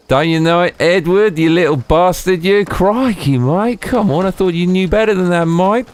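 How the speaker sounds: high-pitched, hammed-up Australian accent; playfully indignant Australian mate; mock Aussie scolding. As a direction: pitch it way up like an outraged Aussie caricature.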